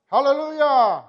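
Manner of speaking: loud, high ringing "Hallelujah!"; joking shouted hallelujah; hallelujah in Italian. Shown as a demonstration. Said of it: "how you say it in Italian".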